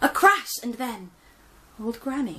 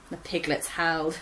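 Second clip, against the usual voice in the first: usual speaking voice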